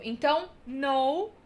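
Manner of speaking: normal talking voice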